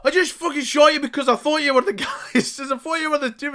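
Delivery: very high, loud fast shout; Melgrim shouting. Acted. Melgrim shouting over the gun to the orc, frantic. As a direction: rattle off the excuse at full volume. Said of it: "Melgrim's voice over the gun".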